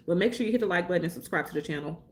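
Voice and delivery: rattled off fast; quick throwaway plug. Shown as a demonstration. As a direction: rush through the reminder. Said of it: "Let me slow down because I know I talk fast".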